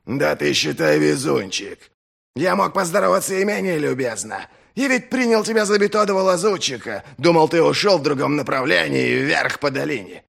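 loud rough northern fighter; gruff warrior voice. Acted. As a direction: bark it like a hardened soldier. Dow's gruff character voice.

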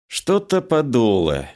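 ordinary delivery, nothing marked

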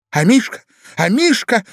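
high, loud calls of a lady; crying out. A narrator's reading. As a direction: cry out for the pet, pitched high. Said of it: "закричала дама". (дамский крик)

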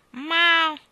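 high, loud meow; cat meow. A narrator's reading. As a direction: mew defiantly like a cat. Cat's meow. (miagolio acuto)

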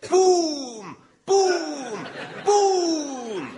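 loud high booming footsteps; giant footsteps. Acted. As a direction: stomp it out vocally. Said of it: "my big, giant boots".

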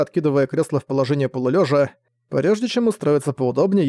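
usual speaking voice

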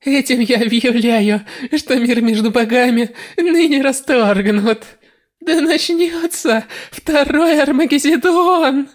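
loud triumphant declaration, rising high; exclaiming. Acted. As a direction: proclaim war with villainous triumph. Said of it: "воскликнула".

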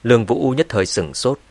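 ordinary delivery, nothing marked

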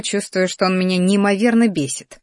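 plain reading voice

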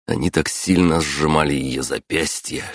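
usual speaking voice

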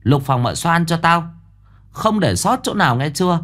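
a high, domineering matriarch barking orders; commanding old mistress. Acted. Mother-in-law orders servants to search the room, high and commanding. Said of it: "rồi lệnh cho đám người".

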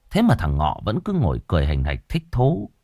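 plain reading voice

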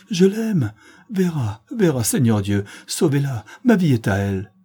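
frantic, impassioned cries, starting high; losing all control. A narrator's reading. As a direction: cry out as if losing your head. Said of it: "perdant tout à fait la tête". (éperdu, hors de soi)

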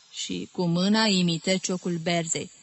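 usual speaking voice

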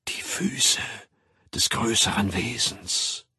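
hushed, penetrating stage whisper; muted whisper. A narrator's reading. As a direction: whisper it slowly and intently. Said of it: "zu einem Flüstern gedämpft weiter".

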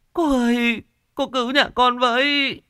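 high, pleading voice; pleading old woman. Acted. Old woman kneeling before the shaman, high pleading. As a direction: beg on your knees for help.

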